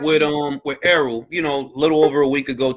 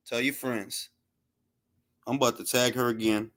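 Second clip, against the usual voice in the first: ordinary talk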